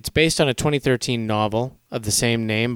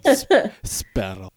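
sparrow said slowly as speterl in a Russian accent; slow, halting Russian accent; slower than usual. Shown as a demonstration. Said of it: "say sparrow in a Russian accent".

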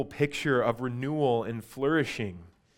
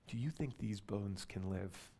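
soft, hushed whisper, much quieter; 5 semitones lower, 15 dB quieter than usual. Acted. God whispering into Ezekiel's ear, hushed and soft. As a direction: breathe the question close in his ear. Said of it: "you can almost hear him whispering over Ezekiel's ear".

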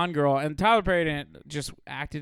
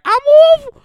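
very high, very loud shrieking creep; screechy creepy addict; 16 dB louder than usual. Retold or imitated. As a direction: shriek it like a deranged back-alley butcher. Imitating heroin addict in movie.

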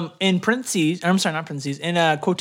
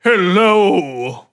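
gravelly, grinding rumbling rock voice. Acted. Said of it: "the man says in a gravelly voice".

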